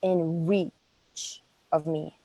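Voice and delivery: ordinary talk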